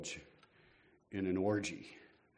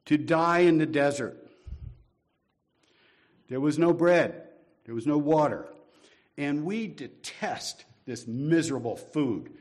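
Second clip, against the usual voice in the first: loud griping, slowing on the last line; loud complaint; 3 semitones higher, 7 dB louder than usual. Retold or imitated. Israelites complain to Moses, loud and aggrieved. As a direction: complain loudly about the desert food. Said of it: "and said".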